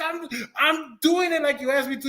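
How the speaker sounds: high-pitched exasperated complaining; mock-mad protest. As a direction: argue back at the program, hammed-up frustration. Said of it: "I would get so mad".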